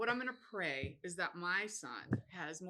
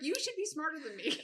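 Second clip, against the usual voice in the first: exasperated daughter; 7 semitones higher than usual. Retold or imitated. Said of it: "I was like".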